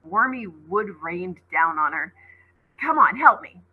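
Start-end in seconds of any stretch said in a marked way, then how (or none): 2.8-3.6 louder, higher urging; urgent. Acted. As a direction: push them to hurry. Urging friends.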